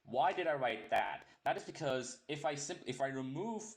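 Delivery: ordinary delivery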